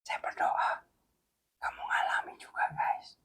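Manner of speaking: hushed prayerful whisper. Real, not acted. Preacher prays viewers experience it, whispered.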